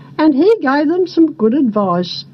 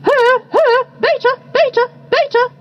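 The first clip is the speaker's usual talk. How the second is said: high chirping bird calls; bird chorus; 11 semitones higher than usual. A narrator's reading. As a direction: chant it like squawking birds. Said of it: "said all of the birds".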